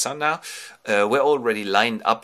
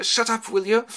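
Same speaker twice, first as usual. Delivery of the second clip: high, louder mock scolding; irritated hushing; 13 semitones higher, 5 dB louder than usual. Ulysses to his barking old dog, irritated hushing.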